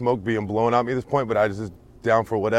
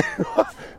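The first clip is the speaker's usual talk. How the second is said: high burst of laughter; 3 dB quieter than usual. Real, not acted. Laughs after the streets joke.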